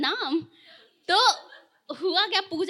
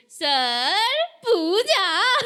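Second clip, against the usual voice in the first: louder, very slow sing-song Puja; teasing; 3 semitones higher, 5 dB louder, much slower than usual. Retold or imitated. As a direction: stretch Puja out to tease him. Brother teases teacher with name. (चिढ़ाते हुए खींचकर)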